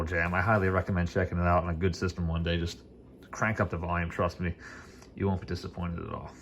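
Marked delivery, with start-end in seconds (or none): none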